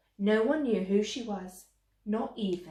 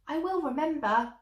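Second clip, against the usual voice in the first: eager cry; 7 semitones higher, 5 dB louder than usual. A narrator's reading. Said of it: "cried Cinderella as she climbed eagerly".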